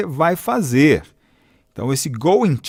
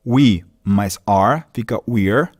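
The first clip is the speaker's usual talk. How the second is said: slow spelled-out parts; 4 semitones lower, slower than usual. Shown as a demonstration.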